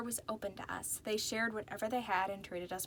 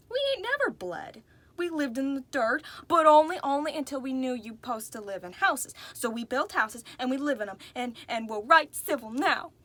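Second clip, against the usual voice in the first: upset; 3 semitones higher, 7 dB louder than usual. A narrator's reading. Reverie defending her kind to Mister L, upset and loud. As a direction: insist hotly, getting worked up. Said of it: "you don't need to get upset".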